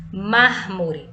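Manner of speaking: "Mármore" loud, with a forceful MÁR; teacher's emphatic "Mármore", opening syllable strong; stress on MÁR. Shown as a demonstration. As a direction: punch the first syllable of "Mármore". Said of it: "pronunciado com mais intensidade, mais força".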